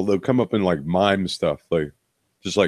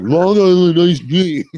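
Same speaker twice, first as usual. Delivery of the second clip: mumbled order, higher and louder, slow; 10 semitones higher, 7 dB louder, slower than usual. Retold or imitated. Mocking the bar patron's order, high slurred mumble.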